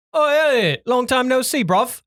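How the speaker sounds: high, bright-pitched mock London greeting; British accent. Narrator imitating cousin's British greeting in a London accent. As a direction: do the cousin's cheery British hello. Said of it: "the most British".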